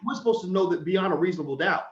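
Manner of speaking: ordinary talk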